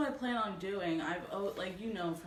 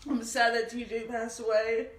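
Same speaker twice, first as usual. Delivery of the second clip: ugly crying; 6 semitones higher, 6 dB louder than usual. Real, not acted. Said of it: "sorry for the ugly crying face".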